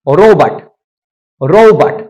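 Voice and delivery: रोबोट said as "rou-bat"; deliberate American रोबोट. Shown as a demonstration. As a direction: glide the O, open the second vowel in रोबोट. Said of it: "रोबोट नहीं".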